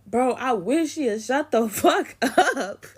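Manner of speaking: high, slow, loud comic plea; mock exasperated outburst, drawn out; retold exasperation. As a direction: imitate the fed-up cousin, breaking into laughter. Exasperated cousin on the phone.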